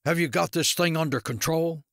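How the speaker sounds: lightly acted imperious district attorney; demanding. A narrator's reading. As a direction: demand an answer from a deputy. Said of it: "Winchester demanded".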